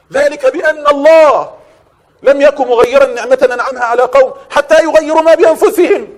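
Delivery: a preacher calling the disobedient back, loud and stretched; preaching at full force. Acted. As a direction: summon the sinner and pound the verse home. Preacher calls and rebukes loudly.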